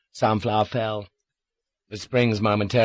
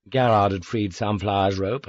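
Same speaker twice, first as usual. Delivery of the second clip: usual speaking voice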